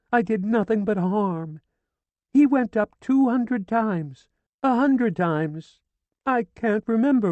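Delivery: high, loud keening grief; wailing lament. A narrator's reading. Widow wailing over her dead husband, grieving. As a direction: wail over the body. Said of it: "She pleaded".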